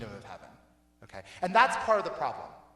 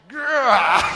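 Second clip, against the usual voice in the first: short comic growl, louder and higher; mock growl; 4 semitones higher, 8 dB louder than usual. Preacher miming an eye gouge with a playful growl. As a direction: growl like you're ripping something out.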